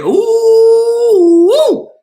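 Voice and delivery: excited whoop. Real, not acted. Excited by a menu item.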